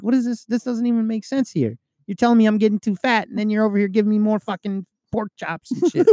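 incredulous complaint. Retold or imitated. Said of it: "I'm like".

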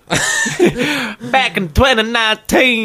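put-on high, slow Texan old-timer; reminiscing grandfather. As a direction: drag it out like grandad remembering. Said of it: "Turning into my grandfather".

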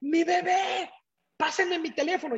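high-pitched cry of alarm, reenacted; reenacting a desperate high yell; retold panicked shout. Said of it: "empiezo a gritar".

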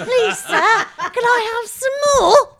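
high, loud begging orphan; Oliver Twist. Pretend. Friend mocking a bad accent, shrill orphan plea. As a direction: wail it slowly like a Cockney waif. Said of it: "you just watched Oliver Twist".

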